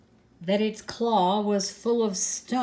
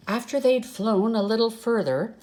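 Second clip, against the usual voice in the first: ordinary delivery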